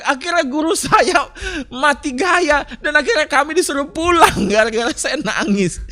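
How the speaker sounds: high and loud, laughing through the words. Real, not acted. Preacher telling the congregation his school story, laughing. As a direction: giggle out how the teacher gave up.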